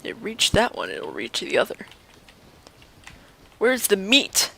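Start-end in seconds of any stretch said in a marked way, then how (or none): none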